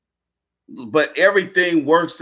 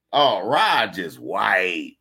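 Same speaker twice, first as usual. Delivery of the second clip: normal talking voice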